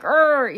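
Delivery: high, exasperated "urgh"; mock growl of mounting annoyance; annoyed growl. Mom getting fed up at the checkout, exasperated groan. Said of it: "I'm getting more annoyed".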